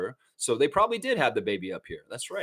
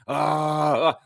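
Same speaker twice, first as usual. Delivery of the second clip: lightly voiced nervous puppet; Telly Monster voice; slower than usual. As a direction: fret like a worried puppet.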